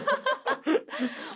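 high cackling laugh; gleeful villain cackle; evil chuckle. Real, not acted. Laugh after "suck it.

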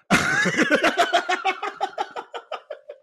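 shrieking laugh; high squealing laugh. Real, not acted. Laughing at the scene.

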